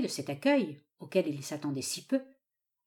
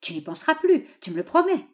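high, insistent coaxing question; insistent father seeking a promise; pleading old father; 8 semitones higher, 7 dB louder than usual. A narrator's reading. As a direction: press her for agreement, voice raised.

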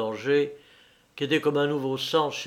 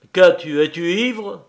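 loud, higher, alarmed questions; grave and worried; 4 semitones higher, 8 dB louder than usual. A narrator's reading. Man questioning the hostile woman, worried. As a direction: demand it with urgent concern. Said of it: "d'une voix grave et inquiète".

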